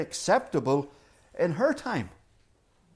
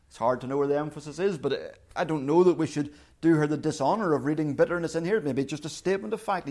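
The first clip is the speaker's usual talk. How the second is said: ordinary talk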